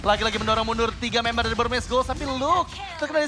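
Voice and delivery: loud rapid fight calling; hype play-by-play. Real, not acted. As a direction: call every move loudly. Play-by-play of second teamfight. (komentator pertarungan yang heboh)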